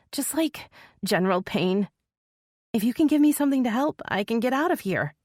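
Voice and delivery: put-on anxious girl begging for meds; pleading young woman. A narrator's reading. Lexi pleads for pain medicine.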